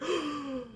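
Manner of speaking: sharp high gasp of shock; a hammed-up shocked inhale; mock gasp. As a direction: gasp as if catching them in the act. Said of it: "And he's like".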